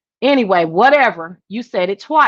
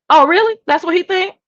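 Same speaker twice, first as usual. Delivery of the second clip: indignant surprise; 9 semitones higher, 5 dB louder than usual. Real, not acted. Indignant at the viewer's comment.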